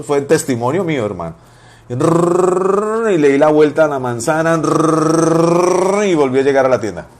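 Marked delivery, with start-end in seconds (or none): 1.9-3.1 long sustained tongue trill; practised rolled R. Retold or imitated. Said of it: "y empecé a rrrr". 4.6-6.0 long sustained tongue trill; practised rolled R. Retold or imitated. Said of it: "y empecé a rrrr".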